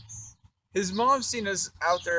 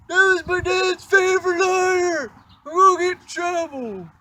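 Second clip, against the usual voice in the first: put-on whiny panicked kid; 13 semitones higher, 10 dB louder than usual. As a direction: wail like a kid in trouble. Bobby afraid of dad.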